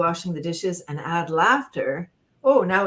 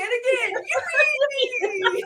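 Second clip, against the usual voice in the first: higher joyful yippee; one more cheerful yippee; cheering yippee. Shown as a demonstration. Leader leading the last yippee, higher. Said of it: "like we really mean it".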